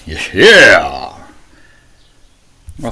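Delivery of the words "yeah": swooping sarcastic yeah; mock excitement. Preacher mocking excitement about killing Jesus, mock excitement. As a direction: cheer it with fake enthusiasm. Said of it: "Getting excited about killing Jesus".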